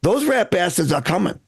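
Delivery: put-on a light Boston townie accent running a rowdy meeting; gruff Boston regular calling the meeting to order, only a hint of accent. Boston townie chairing the Sons of Liberty meeting, light Boston accent. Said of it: "people in Boston spoke with the same accent they have now".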